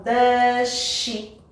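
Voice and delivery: DOES SHE as "duh-shee", the S melting into SH; natural linking. Shown as a demonstration. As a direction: join DOES SHE with one SH sound. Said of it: "vai ser bem mais natural".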